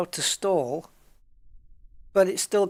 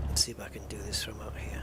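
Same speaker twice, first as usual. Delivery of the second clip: hushed focused whisper; 6 semitones lower, 7 dB quieter than usual. Real, not acted. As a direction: whisper to yourself while concentrating. Concentrating on landing.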